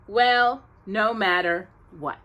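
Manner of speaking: plain reading voice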